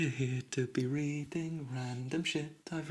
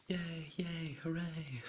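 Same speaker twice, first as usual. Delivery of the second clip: mock cheer; 5 semitones higher, 6 dB quieter, much slower than usual. Unenthusiastic cheer before reading.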